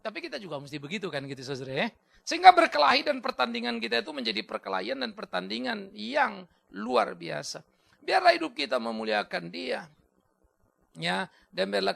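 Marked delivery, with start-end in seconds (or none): 2.2-9.9 raised, higher pulpit voice; emphatic sermon delivery; rousing preaching. Real, not acted. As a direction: exhort the congregation, pitch lifted.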